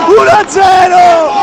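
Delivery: shouted goal call, high and loud; breathless italian goal commentary. Real, not acted. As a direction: scream the score out.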